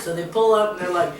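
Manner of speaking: normal talking voice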